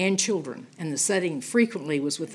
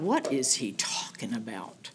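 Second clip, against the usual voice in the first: hushed, confused whisper; whispered aside of the crowd; whispered puzzlement; 7 semitones lower, 8 dB quieter than usual. Retold or imitated. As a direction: mutter it under your breath, baffled. Crowd puzzled by strange words.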